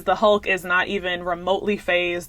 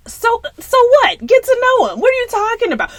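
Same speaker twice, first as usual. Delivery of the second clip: much higher, indignant "So, so what?"; exasperated disbelief; 5 dB louder than usual. Retold or imitated. Telling her friend about the rejection, high and indignant. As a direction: squeal it at your friend in outrage. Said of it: "I looked at my friend and I was like".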